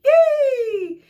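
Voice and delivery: high squealing yay; a child's delighted cheer. A narrator's reading. Group B cheering their prize, a shrill yay.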